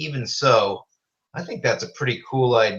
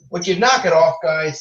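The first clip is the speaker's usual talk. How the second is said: louder, higher outburst at the pets; exasperated scolding; 6 semitones higher, 5 dB louder than usual. Real, not acted. Host interrupts his own question to pets, exasperated scolding. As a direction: break off mid-sentence and snap at them.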